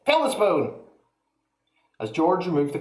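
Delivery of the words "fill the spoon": high fast sour grandma voice; snapping old woman. A narrator's reading. Grandma rebuffing George, high and fast. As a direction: snap it like a nasty old hag.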